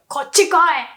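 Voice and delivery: quick, forceful summons; aggressive command. Shown as a demonstration. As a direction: order them over here sharply. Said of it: "wanna sound more aggressive".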